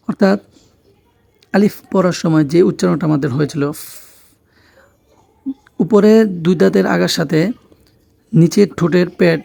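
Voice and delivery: ordinary talk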